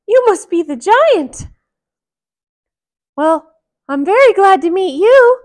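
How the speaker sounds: high, loud mouse voice; a little high mouse greeting; small voice. Acted. Mickey greeting the giant in a small high voice. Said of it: "Mickey said in a small voice".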